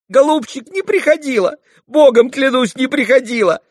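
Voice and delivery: high, loud pleading denial; frightened swearing. A narrator's reading. Fenya swearing to Mitya she never returned, frightened plea. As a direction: insist desperately, voice way up. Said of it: "заклялась, забожилась Феня".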